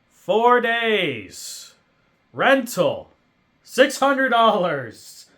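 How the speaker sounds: loud, slow, disbelieving outrage; fuming over the rental price; outraged. Real, not acted. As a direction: hammer each fact home.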